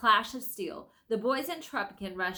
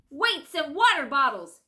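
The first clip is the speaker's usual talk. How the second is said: angry; 7 semitones higher, 9 dB louder than usual. Acted. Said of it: "came an angry voice".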